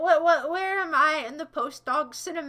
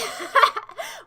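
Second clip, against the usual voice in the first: sudden high burst of laughter; 10 semitones higher, 7 dB louder than usual. Real, not acted. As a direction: crack up at yourself. Laughs at own joke.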